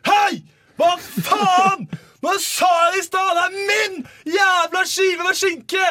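high, very loud furious shout; demonstrated angry screaming. Shown as a demonstration. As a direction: scream it like a full-blown domestic row. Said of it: "Men det er liksom aldri sånn".

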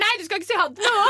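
very high, hammed-up indignant protest; whiny tantrum. Drunk woman forbidding goodbyes on a night out, high mock-tantrum whine. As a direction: act out a drunken tantrum, pitch shooting up. Acted quarrel with boyfriend's friend.

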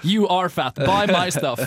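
loud, pushy ad pitchman; mock ad voice. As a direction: sell it like a cheesy TV ad. Mocking TV diet ads. (hånende reklamestemme)

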